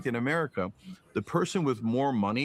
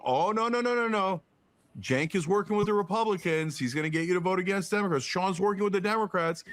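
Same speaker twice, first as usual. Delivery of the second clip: higher, hurried mock panic; mock alarmed media; 6 semitones higher, faster than usual. Host parodying media warnings about the pledge, higher and frantic. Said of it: "they'll go".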